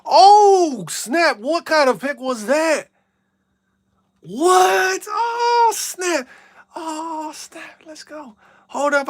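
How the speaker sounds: yelling at the screen in disbelief; stunned outburst. Real, not acted. Interception shocks streamer mid-game.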